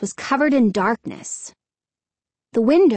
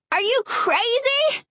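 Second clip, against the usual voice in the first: high, slow incredulous shout; 12 semitones higher, slower than usual. Acted. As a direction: shout it up at them. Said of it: "she shouted into them".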